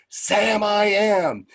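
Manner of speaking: high, loud mock chorus; kids shouting out. Kids joining in the reading, imitated shout. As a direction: call it out like a class of children. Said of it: "the kids shouted out".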